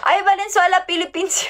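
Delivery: ordinary talk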